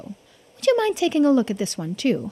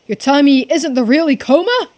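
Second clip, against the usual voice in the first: shocked disbelief; 3 semitones higher, 8 dB louder than usual. Acted. Shocked by identity reveal.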